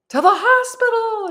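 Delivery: imitated high, loud cry; excited shouting. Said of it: "shouting in Arabic some more, all excited".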